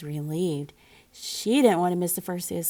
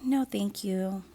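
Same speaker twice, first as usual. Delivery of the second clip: sighed; slower than usual. Acted. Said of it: "sighed Camilla".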